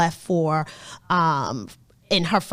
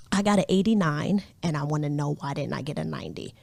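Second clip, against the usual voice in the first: softer, fast, fretful complaint; fretful mutter; 5 semitones lower, 7 dB quieter, faster than usual. Retold or imitated. Teen self explaining the lost point, a fast, soft grumble. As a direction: rush it out under the breath, upset. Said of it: "teen self quoted".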